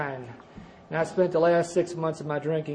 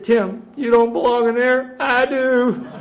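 higher, louder, slow tearful plea; tearful friend; 6 semitones higher, 6 dB louder, slower than usual. Retold or imitated. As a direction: voice a drunk buddy near tears. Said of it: "He's just got tears coming down his face".

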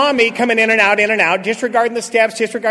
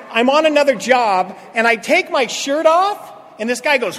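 ordinary talk, nothing marked

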